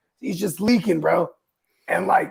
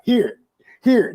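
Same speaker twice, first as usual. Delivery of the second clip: higher, louder retold offer; eager offering; 10 semitones higher, 7 dB louder than usual. Neighbor coming by with a big jar, loud offering. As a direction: call it out like handing out treats.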